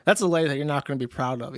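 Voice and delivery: usual speaking voice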